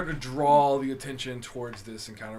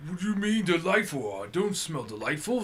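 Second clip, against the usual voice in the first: offended orc; 6 semitones higher, 5 dB louder than usual. Acted. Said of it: "is what he's gonna say".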